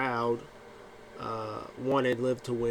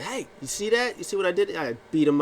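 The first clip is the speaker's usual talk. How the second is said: high, excited mock gloat; hammed-up braggart showing off; 7 semitones higher, 4 dB louder than usual. [name] gloating to Vince, mock excited.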